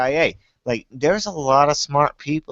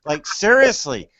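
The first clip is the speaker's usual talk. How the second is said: shrill, much higher, loud complaining put-on voice; whiny New Englander; 14 semitones higher, 5 dB louder than usual. Mocking New Englanders complaining about a man on fire, high and whiny. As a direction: whine like a local who can't be bothered. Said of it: "That's what they're like in New England".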